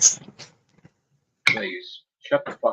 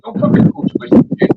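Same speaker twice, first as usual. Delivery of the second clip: angry yell; 7 dB louder than usual. Real, not acted. Ranting at blocked online troll.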